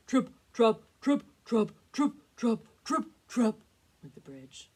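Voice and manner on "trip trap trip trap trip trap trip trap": very loud, punched hoofsteps; heavy tramping hooves. A narrator's reading. Narrator voices the big goat crossing, heavy loud hoofsteps. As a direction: stamp each word out hard. Biggest goat's hooves on bridge.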